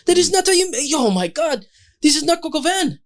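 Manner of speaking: put-on high, huffy French accent; French chef. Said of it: "a Frenchman would go".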